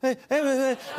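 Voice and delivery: high, breathless burst of heys; Jimmy. Shown as a demonstration. Comedian mimicking the host to his face, high breathy impression. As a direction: imitate the host's excited, winded greeting. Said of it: "I'm sorry, that's an impression".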